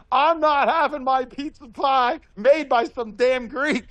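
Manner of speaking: hammed-up grandfather refusing the Greek pizza; imitating angry grandpa. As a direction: grandson mimicking his grandfather's outraged refusal, mock indignant. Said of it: "my grandpa flips out and he says".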